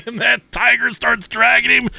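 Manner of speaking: ordinary talk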